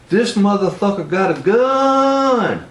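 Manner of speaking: high, slow, frightened shout of alarm; panicked yell. Retold or imitated. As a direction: yell it as if you just saw a gun.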